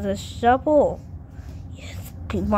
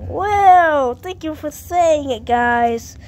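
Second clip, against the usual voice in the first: loud, higher, showman's thanks; excited thanks; 7 semitones higher, 8 dB louder, slower than usual. Acted. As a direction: thank the crowd like a stage magician. Grateful after magic words.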